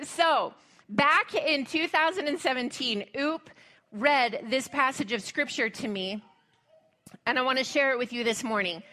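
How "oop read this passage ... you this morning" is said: ordinary talk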